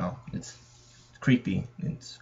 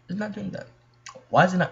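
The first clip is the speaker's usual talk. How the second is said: small high complaint; 7 semitones higher, faster than usual. Real, not acted. Repeats complaint about stuck loading screen.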